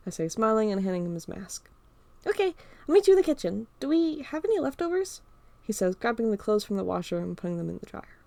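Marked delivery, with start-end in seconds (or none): 2.3-5.2 higher, quick, cheerful friend voice; Carl's voice. Acted. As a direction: breeze through it in the friend's higher voice. Carl voiced by narrator.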